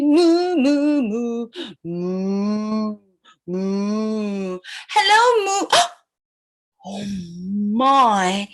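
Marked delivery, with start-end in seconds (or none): 0.0-4.6 low, drawn-out moo; cow mooing. Acted. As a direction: moo like a cow, deep and long. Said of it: "The cow on a farm say".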